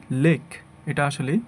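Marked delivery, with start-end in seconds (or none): 0.1-0.4 লেক with a short pure e; common mispronunciation. Shown as a demonstration. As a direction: say লেক as "lek", no glide. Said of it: "এটাকে আমরা বলি".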